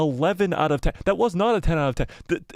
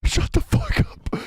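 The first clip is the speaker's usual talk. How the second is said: breathless, shocked hiss of disbelief; high strained shocked whisper; stunned disbelief. Real, not acted. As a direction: gasp it out in shock, barely voiced. Shocked at a scene on screen.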